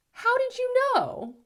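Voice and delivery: put-on high, loud gasp of childlike amazement; a little kid stunned that uncle knew; amazed child. Child asks Uncle Bobby about the hamster in amazement.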